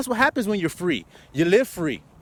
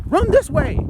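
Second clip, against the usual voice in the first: mock lure; 7 dB louder, slower than usual. Mock voice luring snake.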